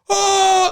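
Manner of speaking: imitated crowd scream. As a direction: scream like a fan seeing the star. Crowd member losing it.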